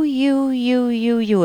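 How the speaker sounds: high, drawn-out, jabbing "you"s; finger-pointing accusation. Shown as a demonstration. Host demonstrating aggressive language to a caller, accusing. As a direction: point the finger with every word. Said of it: "Sometimes it's called finger pointing language".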